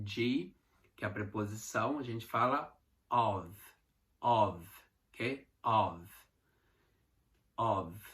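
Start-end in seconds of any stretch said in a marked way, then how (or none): 5.6-8.0 "Of." voiced, the F sounded as V. Shown as a demonstration. Teacher models "Of." with a V sound. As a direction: say "Of." ending in a clear V, not F. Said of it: "pronúncia é como se fosse um V".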